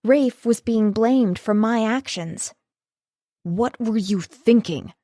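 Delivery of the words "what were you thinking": fierce, accusing demand. A narrator's reading. Connor rounds on Rafe, a fierce demand. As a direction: snap the question at her with fury. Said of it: "Connor asked fiercely".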